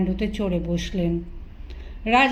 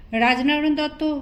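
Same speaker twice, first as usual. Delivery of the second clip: usual speaking voice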